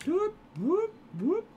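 high, rising whoops; quick swoops up into falsetto; soft siren-like whoops; playful whooping. Pretend. Playful noises while playing.

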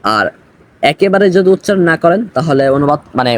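ordinary delivery

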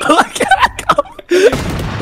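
high squeaky laughing words. Real, not acted. Laughs at a play.

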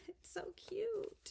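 breathy delighted whisper; gushing whisper. Real, not acted. Unboxing a gift from Roseanne, whispered delight. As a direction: whisper it like a secret treasure.

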